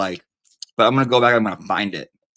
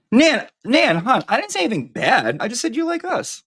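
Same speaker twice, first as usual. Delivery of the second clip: much higher, sing-song coaxing; cooing to Nan; 12 semitones higher than usual. Real, not acted. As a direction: sweet-talk her like a pet you're soothing. Coaxing Nan after being misheard.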